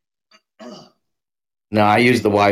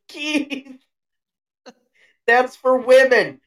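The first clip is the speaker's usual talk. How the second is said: higher put-on mom voice, slow start; mock mom reply; 9 semitones higher, 4 dB louder than usual. Co-host playing mom answering the kid in a higher voice.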